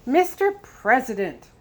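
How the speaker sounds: loud, high call across the room; bursting in with a shout; yelled. A narrator's reading. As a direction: yell it to get his attention. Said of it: "the American yelled".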